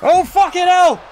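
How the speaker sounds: very high, loud, drawn-out exclamation; stunned commentator shout; shocked outburst. Real, not acted. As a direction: cry out slowly in disbelief. Shocked reaction to a wrestling move.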